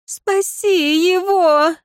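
high, drawn-out wail; keening, high-pitched entreaty; tearful plea. A narrator's reading. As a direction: wail it slowly, as if crying. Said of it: "заплакала девица".

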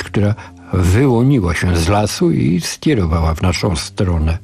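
ordinary delivery, nothing marked

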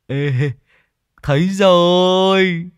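high, loud, drawn-out cry; excited calling out. Acted. Tý gleefully spots the ripe mango tree, excited calling out. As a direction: stretch it out in a high, loud call.

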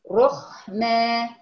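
رحمة very slow with a thick rounded R; تفخيم الراء. Shown as a demonstration. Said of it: "رو، وحسين الضم". (رحمة ببطء وراء مفخمة مضمومة)

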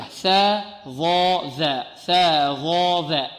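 ثا ظا ذا drawn out slowly, tongue between the teeth; tongue-tip letters. Shown as a demonstration. Teacher drilling the tongue-tip letters, very slow. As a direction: sound out ثا ظا ذا one by one, very slowly. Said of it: "تخرج من طرف اللسان".

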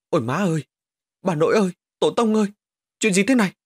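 a terrified man calling on his ancestors, climbing in pitch; panicked wailing. Acted. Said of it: "Hắn run rẩy nói một mình".

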